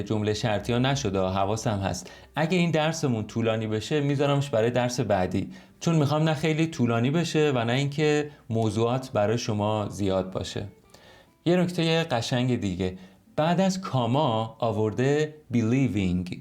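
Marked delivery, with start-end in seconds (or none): none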